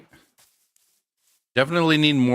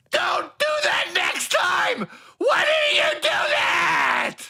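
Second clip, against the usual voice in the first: slow throat-tearing screech, much higher; hammed-up crying rant at the viewer; mock screaming tantrum; slower than usual. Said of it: "That hurt my throat to do".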